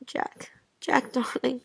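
whispered, breaking off into a small laugh; soft hushed whisper down the phone; mumbled whisper. Acted. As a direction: murmur it close to the mouthpiece. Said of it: "how can I hear you when you mumble like that?".

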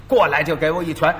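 ordinary delivery

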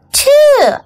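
high, loud burst of surprise; surprised. A narrator's reading. Child learns mom bought two kittens, acted surprise. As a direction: exclaim it high in storybook astonishment.

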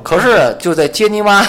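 high, slow, loud Beichen dialect; Beichen accent. Retold or imitated. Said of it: "在北辰也用".

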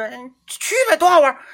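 high, bright-voiced friend urging you along; coaxing friend. Friend inviting a coworker skiing, eager coaxing. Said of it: "他说".

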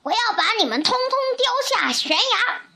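high-pitched, furious acted bellow; raging storybook giant; roaring giant. A narrator's reading. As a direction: roar a threat, pitch driven up. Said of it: "巨人咆哮着".

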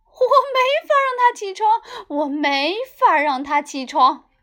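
high, loud frantic mom; panicking and at her wits' end. Acted. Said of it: "抓狂地说道".

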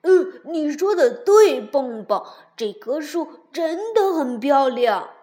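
loud, happy agreement; cheerful. A narrator's reading. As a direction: beam with pleasure. Tiaotiao agrees happily.

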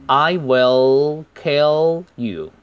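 slow WILL and KILL, each L held long; held L sounds. Shown as a demonstration. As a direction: hold the tongue tip behind the upper teeth on the L of WILL and KILL. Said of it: "是舌尖顶在上齿的根部". (WILL、KILL 的边音L顶住不松开，慢读)

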